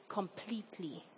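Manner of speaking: hushed, weighty "completely" after a pause; emphasis on completely. Real, not acted. Preacher giving the congregation the third truth, "completely" set apart. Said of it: "completely" in bold capitals".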